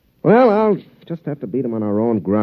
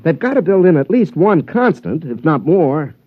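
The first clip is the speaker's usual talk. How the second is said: eager; 6 semitones higher, 4 dB louder than usual. Acted. Golfer puzzling over the robot.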